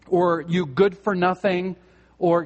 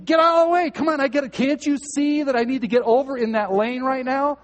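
higher, louder impatient thoughts; mock road rage; 8 semitones higher, 4 dB louder than usual. Driver stuck in traffic lane, impatient mock anger. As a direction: huff it out behind the wheel. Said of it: "and you're thinking".

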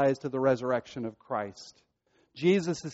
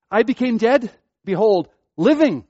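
high, loud "dead", pause, punched "living"; stressed great contrast; 6 semitones higher, 7 dB louder than usual. Shown as a demonstration. Preacher showing readers the great contrast, "dead" and "living" stressed. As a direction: set "dead" against "living", each word hit loud and high. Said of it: "is this great contrast".

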